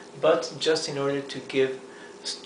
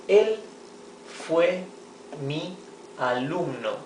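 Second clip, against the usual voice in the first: slow word-by-word reading; 3 semitones higher, slower than usual. Shown as a demonstration. Teacher dictating an example sentence to students, slowly. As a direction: dictate it one word at a time. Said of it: "teacher reads example word by word".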